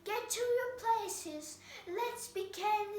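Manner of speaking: high, loud royal command; shouted. A narrator's reading. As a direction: order everyone to their places. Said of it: "the queen shouted".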